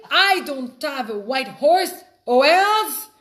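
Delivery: hint of an insulted king; mock indignant. Second king rereading the threat, mock outrage. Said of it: "He's like".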